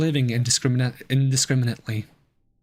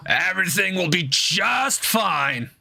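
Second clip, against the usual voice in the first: slow spooky ghost voice; drawn-out eerie reassurance; 4 semitones higher, slower than usual. Acted. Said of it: "low-key goes, uh, crazy mid-sentence".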